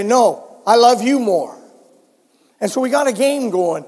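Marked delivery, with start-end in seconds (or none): none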